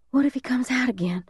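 put-on high-pitched anxious child's voice; worried little boy. A narrator's reading. Little brother worrying to big brother in hiding, high anxious voice. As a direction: fret high, like a young kid.

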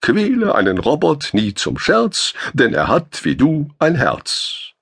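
wounded man lecturing the officer with a rhyme, mock-solemn. A narrator's reading. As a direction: recite it like a schoolmaster's moral, slowing at the end. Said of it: "erhob dozierend einen blutverschmierten Zeigefinger".

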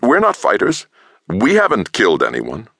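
high, earnest plea; pleading. A narrator's reading. Scientist pleading his peaceful case, high and earnest. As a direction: beg them to believe you. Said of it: "Delos pleaded".

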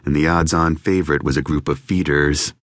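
usual speaking voice

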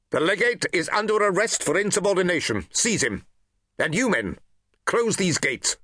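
high, commanding orders; cold command. Acted. Praetorian officer ordering his men to seize the legate, commanding. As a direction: order the arrest with authority.

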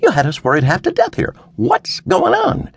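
worried dad, pitched high; scolding father. A narrator's reading. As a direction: press for an explanation like a father. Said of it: "sounding every bit like a father".

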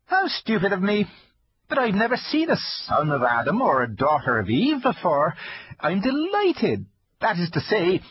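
high, timid fawn voice; nervous polite faun; shy fawn voice. Acted. Fawn character voice.

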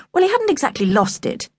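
ordinary delivery

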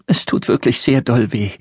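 put-on a little girl whimpering into the dark; hushed child's voice; small girl voice. A narrator's reading. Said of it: "versuchte sie es dann noch einmal".

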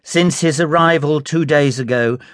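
ordinary delivery, nothing marked

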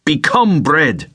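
high, slow commanding shout. Acted. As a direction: bark the command at the stones. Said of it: "Nikolai shouted".

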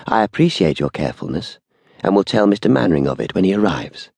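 ordinary delivery, nothing marked

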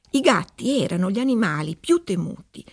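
plain reading voice

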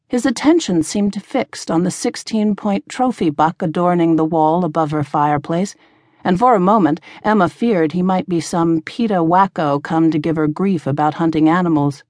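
ordinary delivery, nothing marked

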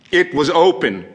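high, loud and agitated madman; rising outrage with hard-hit key words; mounting fury. Acted. Madman describing the hideous vulture eye, furious and agitated.

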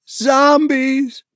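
put-on kids blurting it out in alarm; scared children shout the news to parents; children chorusing. A narrator's reading. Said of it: "the children chorused".

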